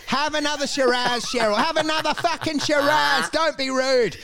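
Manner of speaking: high, insistent party host; mock-pushy host urging Cheryl. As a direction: press the wine on Cheryl.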